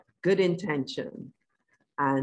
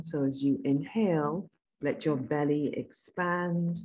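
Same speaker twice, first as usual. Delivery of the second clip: guided meditation; 3 dB quieter than usual. Acted. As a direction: calmly guide them inward.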